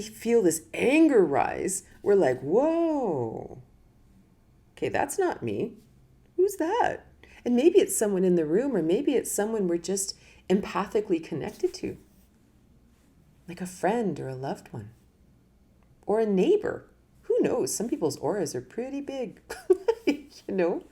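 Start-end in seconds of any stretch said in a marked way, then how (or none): 2.4-7.1 mock startled. Acting a startled inner voice. 19.4-20.0 high laugh. Real, not acted. Laughs at the aura joke.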